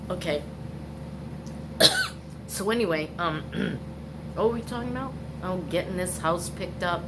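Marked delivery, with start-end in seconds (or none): 0.5-2.4 coughing, drink went down wrong; sputtering cough; choking cough. Real, not acted. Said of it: "that went down the wrong way".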